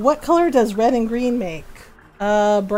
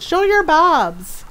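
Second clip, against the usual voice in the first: higher, drawn-out mock yell; retold yell; 5 semitones higher, 4 dB louder, much slower than usual. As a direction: re-enact a mom yelling out to tease. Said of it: "then I just yell out".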